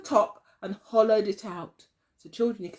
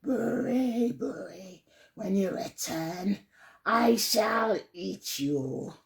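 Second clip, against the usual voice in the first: growling tiger; 2 semitones lower, slower than usual. Acted. Tiger growling a slow threat at Buri. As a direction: let the growl drag on slowly. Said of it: "growled the tiger".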